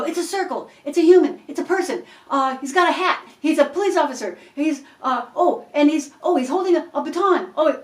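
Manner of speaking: upbeat encouraging guesser; excited guessing. Shown as a demonstration. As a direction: cheer the drawer on with every guess. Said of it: "So I go".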